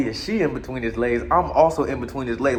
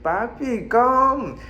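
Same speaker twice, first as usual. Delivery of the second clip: woman's voice; 9 semitones higher, much slower than usual. Retold or imitated. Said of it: "And then she said".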